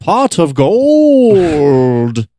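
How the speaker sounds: high, very slow leprechaun Irish drawl; leprechaun Irish accent. Pretend. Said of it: "To not sound Irish".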